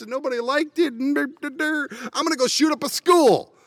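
high, whiny mocking voice; mocking loser voice. Vlogger mocking would-be shooters in a high whiny voice. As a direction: mimic a pathetic whiner. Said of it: "the other stupid people go".

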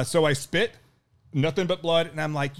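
normal talking voice

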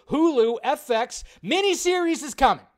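high, loud announcement; excited prediction. Real, not acted. Streamer predicting a miniseries, excited and high. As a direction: sell the coming show with glee.

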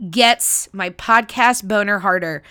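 ordinary talk, nothing marked

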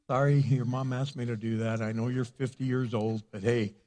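ordinary talk

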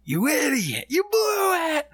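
very high, slowed shouting outburst; yelling at himself. Retold or imitated. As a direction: scream it like a furious regret. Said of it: "I'm like".

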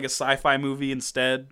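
usual speaking voice

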